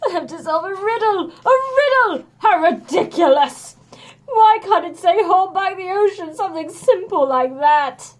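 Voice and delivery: outraged sniffly hero; sniffling complaint. A narrator's reading. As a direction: wail at the silly riddle. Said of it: "Hercules sniffed".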